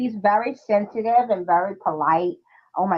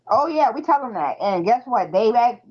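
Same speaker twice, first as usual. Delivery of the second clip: ordinary delivery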